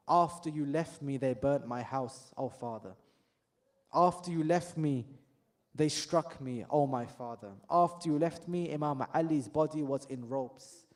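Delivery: low, quiet grieving cry to her father; daughter's lament. Retold or imitated. Fatima weeping to the Prophet, low and grieving. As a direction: mourn each line softly.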